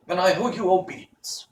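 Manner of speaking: high, hollow-headed wooden man; Pumpkinhead voice. Acted. Jack Pumpkinhead asks Tip where they go, high voice. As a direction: pitch it way up like a carved pumpkin man.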